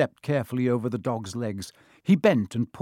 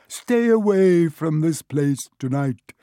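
loud, slow ghostly command, pitched up; croaking; 5 semitones higher, 8 dB louder, slower than usual. A narrator's reading. Harry as the Baron orders Peeves away, loud slow croak. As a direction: croak out a spectral warning, slowly. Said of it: "croaked Harry".

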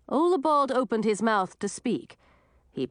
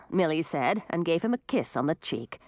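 plain reading voice

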